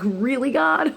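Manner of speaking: high, incredulous complaint to the heavens; exasperated plea. Retold or imitated. Complaining to God about bad timing, high and exasperated. Taxes due while dog sick.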